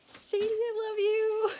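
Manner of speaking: high, loud gush of affection; thrilled, high-pitched outburst; excited squeal. Real, not acted. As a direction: squeal it out to a beloved friend. Moved by friend's surprise gift.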